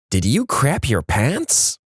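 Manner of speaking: slow, drawn-out question in a put-on voice; mock-concerned question. Partner to trapped driver, slow mock-concerned question. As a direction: stretch out the question for comic effect.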